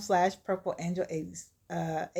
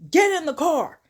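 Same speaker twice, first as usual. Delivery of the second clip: high, loud acted yell; fed-up, explosive mock command; angry shouted order; 8 semitones higher, 8 dB louder than usual. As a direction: bellow at a stubborn father. Kendrick shouts the order again.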